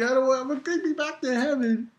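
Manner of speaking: a lightly voiced whiny friend; mock pleading character. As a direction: beg to go back. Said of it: "Ko's like".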